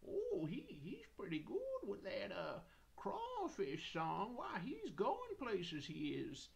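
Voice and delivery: high, swooping cooing gush; hammed-up high-pitched admiration; impressed audience. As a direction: gush slowly like dazzled fans. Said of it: "people were like".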